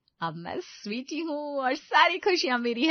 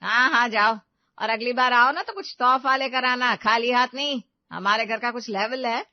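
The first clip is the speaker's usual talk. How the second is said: loud, sneering send-off; mother-in-law sneers at the poor parents, very loud; loud contempt; 10 dB louder than usual. Acted. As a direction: shoo them out with scorn.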